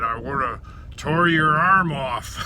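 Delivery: slow, drawn-out mock threat; retold fighting words. Rival buyer threatening to tear an arm off, mock threat. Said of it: "those were fighting words, I guess".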